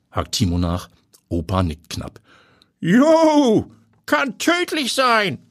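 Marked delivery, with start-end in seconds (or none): 2.8-5.4 put-on very high, drawn-out old man; old grandpa. A narrator's reading. Grandpa confirming the poison danger to Timo, high and slow.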